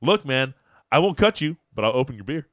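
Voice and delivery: loud punchline delivered to the guy; retold standoff. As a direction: act out a mock tough comeback. Retold standoff with bottle opener.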